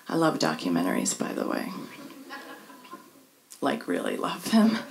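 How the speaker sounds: hushed little confession; quiet aside. Real, not acted. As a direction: drop it low like a secret to the room.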